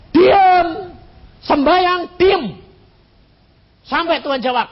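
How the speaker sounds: loud, high, spaced commands; emphatic instruction. Real, not acted. Preacher tells the congregation what to do: loud firm commands. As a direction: preach each word firmly. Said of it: "Lah terus bagaimana?".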